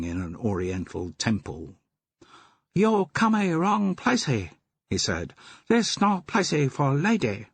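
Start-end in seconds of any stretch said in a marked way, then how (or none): 2.7-4.5 high put-on pidgin English, adding "y" to words; a cheeky opium-den keeper laying on a fake pidgin accent; mock pidgin accent. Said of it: "Do you have to talk like that?". 5.7-7.5 high put-on pidgin English, adding "y" to words; a cheeky opium-den keeper laying on a fake pidgin accent; mock pidgin accent. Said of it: "Adding E to the end of every word".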